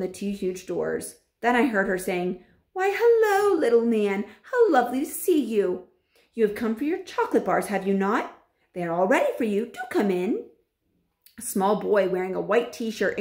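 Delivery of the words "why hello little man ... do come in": put-on disguised witch sweet-talking the boy; sugary witch voice. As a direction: lure him in, all fake sweetness.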